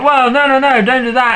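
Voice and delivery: high-pitched protest; mock alarm. Jacob objecting to the wolf pack's plan, mock alarmed. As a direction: imitate someone hurriedly pleading to stop.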